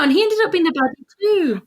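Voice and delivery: ordinary delivery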